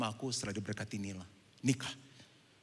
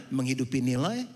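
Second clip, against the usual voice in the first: ordinary talk, nothing marked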